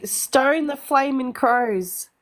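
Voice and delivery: slow, broad Australian model phrase; broad Aussie. Shown as a demonstration. Aussie friend has Americans say it after her, broad accent. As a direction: stretch it out in Aussie for copying. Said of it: "this is the most Aussie you can get".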